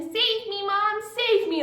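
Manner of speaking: high-pitched mock cry for rescue; high, drawn-out call for help; playful child's scream. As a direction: act out a child crying out to Mom mid tickle fight. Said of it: "hear Molly screaming".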